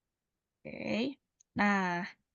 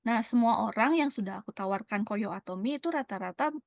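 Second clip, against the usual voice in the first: normal talking voice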